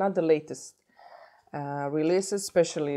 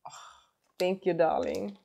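normal talking voice

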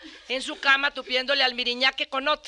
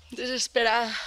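normal talking voice

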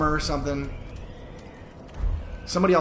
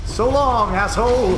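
high, loud mocking shout; loud, high cheeky farewell; 1 gleeful taunt; 11 semitones higher, 7 dB louder, slower than usual. Real, not acted. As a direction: yell a taunt while escaping. Taunting enemy while escaping.

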